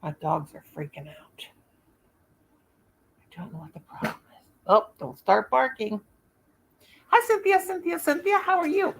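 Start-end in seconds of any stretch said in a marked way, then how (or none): 0.0-4.4 hushed aside. Real, not acted. Aside to viewers. 7.1-8.9 high, bright hello to new viewers; cheery greeting. Real, not acted.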